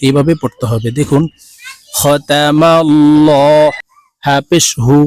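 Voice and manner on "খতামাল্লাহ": খতামাল্লাহ with a thick, heavy 'll-aw', slowly drawn out; মোটা লাম. Shown as a demonstration. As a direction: recite খতামাল্লাহ slowly, the doubled L full and rounded to 'law'. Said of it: "ল বলে অর্থাৎ মোটা করে পড়ব". (খতামাল্লাহ-এর লাম মোটা করে 'ল' বলে ধীরে টেনে)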